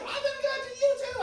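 loud, piercing high imitation; high-pitched voice. Preacher imitating Sandy pressing him, high-pitched voice. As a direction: insist in a mock falsetto. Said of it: "and that high voice".

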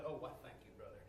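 soft, falsely modest thank-you; mock humble thanks. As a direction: play it coyly humble. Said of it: "I'll be humble".

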